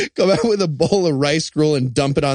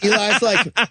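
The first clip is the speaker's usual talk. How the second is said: ordinary talk, nothing marked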